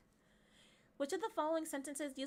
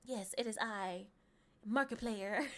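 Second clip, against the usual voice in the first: lightly put-on grand voice, slow and lower at first; Markiplier; 4 semitones lower, slower than usual. Streamer answering as the famous player, mock-grand. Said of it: "Markiplier, is that you?".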